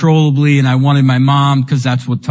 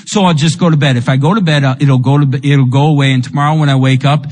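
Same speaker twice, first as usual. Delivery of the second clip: ordinary talk, nothing marked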